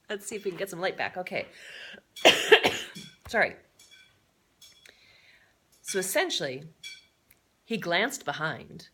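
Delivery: usual speaking voice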